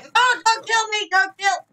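high, loud panicked plea; panicked shriek. Real, not acted. Player begging an attacker to spare them, panicked shriek. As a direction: shriek it, begging for your life.

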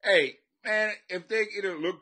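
ordinary talk, nothing marked

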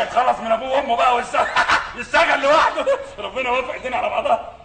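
words bubbling with laughter; laughing while talking. Real, not acted. Man jokes about the baby, laughing through it. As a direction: get through the joke between giggles.

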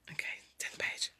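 hushed whisper to a little helper; whispered aside. Real, not acted. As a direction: whisper it privately, under the story.

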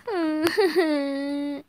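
fake sobbing. Daughter fake crying for her mother. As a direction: put on a wail.

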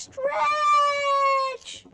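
put-on stretchy high call to children; high stretched-out call. Shown as a demonstration. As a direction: stretch up high, drawn out and sung high. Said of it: "Everybody stand up".

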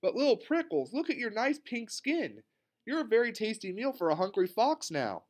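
high, fretful teacher's voice; alarmed warning. A narrator's reading. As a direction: warn the child in rising alarm. Teacher alarmed for child's safety.

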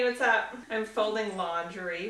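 usual speaking voice

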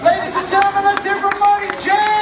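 playful loud showman call; announcer. Pretend. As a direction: hype it up like a host. Mock announcer calls after show.

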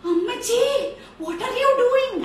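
high, loud granddaughter's cry; shocked shout. Acted. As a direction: scold grandma in loud disbelief. Granddaughter confronts grandmother in story.

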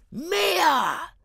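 put-on high sharp exclamation from a drunk brute; exclaiming. A narrator's reading. Drunk carter exclaims over the dead mare, high yell. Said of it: "exclama Mikolka".

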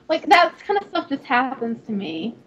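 ordinary talk, nothing marked

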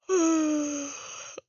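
a big open-mouthed yawn; tired yawn mid-playthrough; loud yawn. Real, not acted. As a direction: let out a long loud yawn. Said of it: "I'm yawning".

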